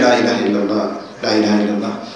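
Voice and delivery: gentle prompting. Retold or imitated. Prophet gently prompts dying man.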